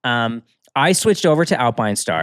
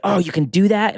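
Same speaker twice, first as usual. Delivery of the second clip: surprised question; 8 semitones higher than usual. Retold or imitated. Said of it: "so he's like".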